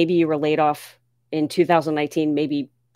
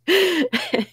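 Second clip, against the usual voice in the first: 1 high giggle; 13 semitones higher than usual. Real, not acted. Greets late-waking viewer Darnell.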